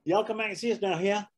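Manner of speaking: fast, high "y'all come back", rising "here"; Southern drawl. Shown as a demonstration. Replaying the Southern farewell, high Southern drawl. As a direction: rattle it off like a Southern host. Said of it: "the word here".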